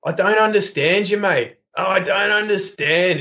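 high, broad Aussie bloke playing dumb; thick Australian accent, acting confused. Degenerate in court plays dumb to the judge, high broad Australian accent. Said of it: "he's, like, really Australian".

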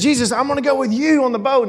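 high-pitched, eager retold plea. Freed man asks Jesus to take him along, eager. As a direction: plead keenly, like a grateful follower.

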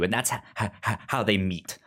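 ordinary talk, nothing marked

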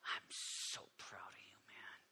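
soft, slow whisper; Jesus whispering praise to John; whispered pride. Retold or imitated. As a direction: breathe it out tenderly. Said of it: "Jesus looks at John and goes".

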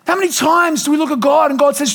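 usual speaking voice

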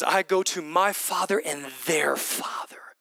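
slow hushed whisper. Acted. Quoting Jesus softly.

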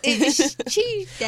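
slow, drawn-out British imitation; mock British accent. Host copies her friend's line, mock British accent. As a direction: echo it slowly in a pretend British accent. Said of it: "I'm British all the way".

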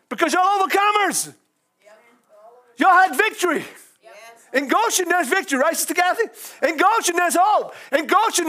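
fervent church preaching; preaching at full force. Acted. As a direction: lift it like a revival sermon. Preaching, raised voice.

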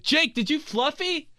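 high-pitched, earnest mock interrogation; mock serious tone. Imitating relative questioning a kid, mock-serious. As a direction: ask it high, as if gravely serious. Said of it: "they always say it in, like, the gr-, most serious tone".

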